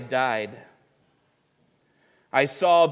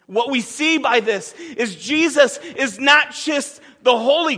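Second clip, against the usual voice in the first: higher and louder, each holy hammered; full-force preaching; 8 semitones higher, 7 dB louder than usual. Acted. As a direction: preach it with rising fire.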